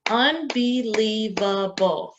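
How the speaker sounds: unbelievable stretched slowly syllable by syllable; syllables pulled apart. Shown as a demonstration. Teacher sounding out unbelievable for the class, slow beats. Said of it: "How many syllables in unbelievable".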